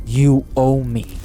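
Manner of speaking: loud, slow, hard-hitting demand; forceful demand. Acted. Cornered gambler to the demon, loud demand. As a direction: slam each word down. Heated casino standoff.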